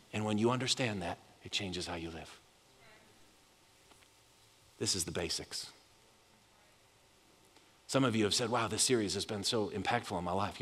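low, soft, intimate sermon voice; soft reflective preaching. Acted. Pastor quietly reassuring the congregation, soft intimate preaching. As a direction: draw them in close and gentle.

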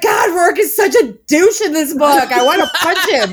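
laughing while quoting the group's complaint; laughing quote. Retold or imitated. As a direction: voice everyone's gripe through laughter. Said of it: "all of us are like".

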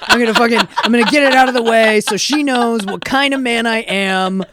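loud, hammed-up bro voice, laughing through it; mock guy psyching himself up. Friend mimics a clueless guy to her podcast cohosts, loud mock bravado. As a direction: play the eager boyfriend bragging to himself. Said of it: "It's just like".

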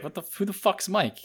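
high, baffled mock confusion; mock confused. Friend playing the biker, baffled about Mike. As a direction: pitch it up, baffled and dumb. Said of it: "you're just like".

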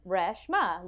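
Reshma said as RESH-ma, short e; spelling pronunciation. Shown as a demonstration. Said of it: "how you would say that in a R E".

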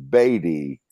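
ordinary talk

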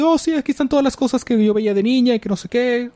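high, wistful remembering voice; nostalgic girl voice. Retold or imitated. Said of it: "y dice".

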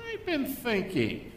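slow, high, airy musing; mock pensive. Doubter announcing his thinking, slow and mocking. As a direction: drawl it out like a self-important doubter. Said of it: "how we always download doubt".

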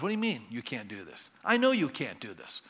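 low, soft, patient voice of God; God answering. Acted. God replies to a struggling believer, low and gentle. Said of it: "God goes".

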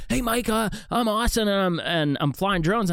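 high-pitched mock introduction, lightly hammed up; eager newcomer. Painter introducing himself to Mike at the roadside, eager newcomer. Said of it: "he's like".

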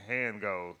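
normal talking voice

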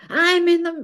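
high, loud mock yell; retold screaming. Boss interrupted at dinner calls back, screaming. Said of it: "you know, screaming".

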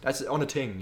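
Irish-style "ting", TH hardened to T. Retold or imitated. Co-host imitating the actor who can't say it, T for TH on "ting". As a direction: say "ting" with a plain T. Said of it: "He can't say the T".

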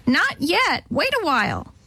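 high parrot mimicry; parrot answer. A narrator's reading. Parrot answers Alice with her own words, squawking. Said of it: "the bird answered instead".

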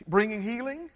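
normal talking voice

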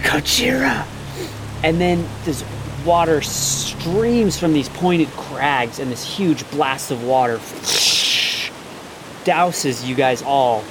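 slow, hushed build-up; game master scene setting. A narrator's reading. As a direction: draw the players in slowly, revealing a monster. GM describes the dragon turtle's emergence.

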